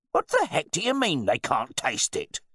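loud, high-pitched outrage; blustering, affronted wine bore; indignant host. A narrator's reading. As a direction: challenge the butler in disbelief. Host challenges his butler.

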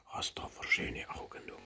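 hushed tender whisper. Real, not acted. Whispering compliments to baby.

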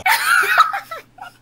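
high, loud burst of laughter. Real, not acted. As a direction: crack up loudly. Bursts out laughing at racist joke.

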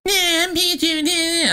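very high squeaky Pikachu voice; mocking Pikachu voice. Mocking Pikachu's voice from the trailer, high and squeaky. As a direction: squeak it out like a cartoon critter. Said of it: "Pikachu starts talking".

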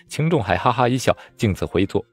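ordinary delivery, nothing marked

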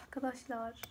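breathless whispered call to friends; fısıltıyla. Real, not acted. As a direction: hush it, just air. Sudden whisper to viewers.

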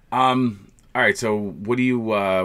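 ordinary delivery

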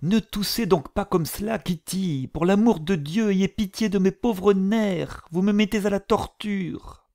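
high, whining scold about her nerves. A narrator's reading. The mother scolds Kitty for coughing, high and nervous. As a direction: nag the coughing girl, voice up high. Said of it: "elle se mit à gronder".

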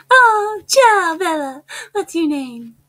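high-pitched attempted Italian character voice; Italy. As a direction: exclaim high, then ease down, in a rough Italian impression. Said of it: "That's my best Italy I can do".

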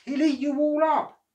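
high, loud frightened warning; enormous lady warning Jack; fearful warning. Acted. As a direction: warn him in alarm. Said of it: "she wailed".

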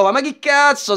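normal talking voice

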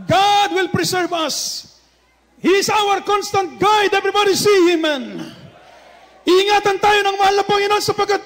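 loud, high, shouted proclamation; preaching at full force. Acted. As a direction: rouse the congregation. Climax of sermon.

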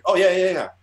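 high, loud rapid-fire agreement; eager yes. Retold or imitated. Artist retelling his yes to a paid offer, eager. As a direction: jump at the offer, retold.

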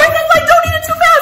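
high zooming play voice; playful high sing-song. Pretend. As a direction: swoop the words up like a flying spoon. Flying popcorn to puppet.

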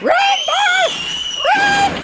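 very high, loud scream; high-pitched yell. Acted. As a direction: shriek it as an urgent order. Yelled comic exclamation.

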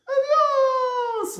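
very high, drawn-out goodbye; squeaky farewell. Pretend. Playful sign-off to the children, squeaky high voice. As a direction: pipe it out in a squeal.

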